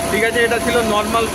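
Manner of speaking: distant tinny phone recording; recorded on a phone mic; phone mic sound. Real, not acted. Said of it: "যে সাউন্ডটা শুনতে পাচ্ছেন সেটা হচ্ছে আমার নরমাল ফোনের সাউন্ড". (ফোনের মাইকে রেকর্ড করা আওয়াজ)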